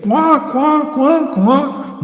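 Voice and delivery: high mocking quacks like a duck. Mocking followers as ducks.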